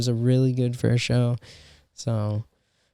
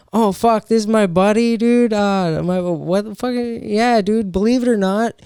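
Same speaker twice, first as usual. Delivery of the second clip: higher, louder joking hype voice; mock buddy intro; 8 semitones higher, 4 dB louder than usual. Comic imagining a joke to the crowd, mock hyped. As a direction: sell the big guy as his pal, hammed-up. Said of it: "or like".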